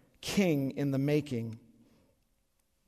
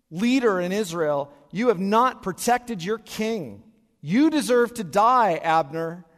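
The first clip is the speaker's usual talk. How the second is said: loud, higher taunting shout, slow and spaced; yelling across to Abner; 6 semitones higher, 10 dB louder, slower than usual. Retold or imitated. David yelling at Abner from the mountain, loud taunt. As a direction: call out a rival from the mountaintop. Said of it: "he yells out to Abner".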